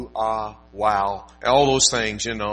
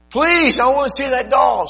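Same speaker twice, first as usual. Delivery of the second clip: put-on much higher, louder child's voice begging; little girl pleading; 14 semitones higher, 9 dB louder than usual. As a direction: squeal like a small girl wanting a toy. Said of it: "She goes".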